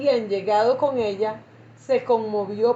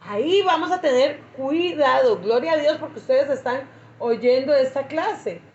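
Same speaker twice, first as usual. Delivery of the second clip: ordinary talk, nothing marked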